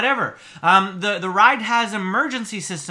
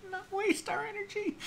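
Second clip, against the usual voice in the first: high, soft squeak through laughter; amused, squeezed and high; laughing; 13 semitones higher, 14 dB quieter than usual. Acted. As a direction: barely get the words out for laughing. Laughing while reading the complaint.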